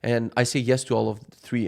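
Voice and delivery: normal talking voice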